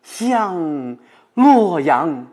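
very slow stretched 向洛阳, rising then falling; drawn-out ending. Shown as a demonstration. Professor finishing the demonstration of the last line, very slow stretched ending. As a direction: hold 向洛阳 long like arriving home.

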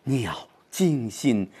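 鸟惊心 slow and soft, weight going inward; 重音往心里走; inward stress. Shown as a demonstration. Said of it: "是往心里走".

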